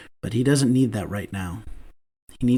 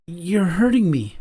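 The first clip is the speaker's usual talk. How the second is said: put-on higher, slower, gentle young man's voice; Damon voiced; 6 semitones higher than usual. A narrator's reading. Damon's voice in narration.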